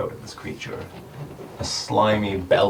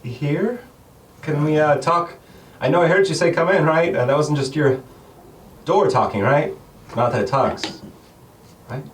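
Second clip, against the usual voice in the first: higher, louder nervous babbling; nervous Pat; 9 semitones higher, 6 dB louder than usual. Acted. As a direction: ramble anxiously at the shopkeeper. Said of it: "Pat speaks up".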